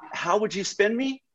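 puzzled that anyone would spin him; mock disbelief.

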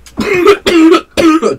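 harsh hacking coughs after a big hit; coughing fit. Real, not acted. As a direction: cough hard and long, lungs burning. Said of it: "Now I can't stop coughing".

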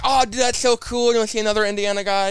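high-pitched excited fan reply. Retold or imitated. Retold excited online comment.

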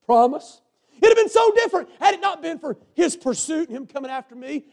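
high, loud preacher's shout; full-force preaching. Real, not acted. Preacher crying out his own testimony to the church.

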